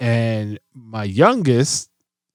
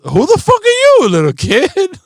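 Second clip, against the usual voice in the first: squeaky, much higher shocked outburst; mock astonishment; 6 dB louder than usual. Dad reacting to his four-year-old's grown-up remark, a high, loud mock-shocked squeak. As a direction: act floored by the kid's grown-up line. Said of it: "I'm like".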